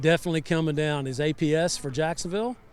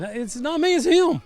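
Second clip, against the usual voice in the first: mock player protest; 11 semitones higher than usual. Player pointing blame at teammate.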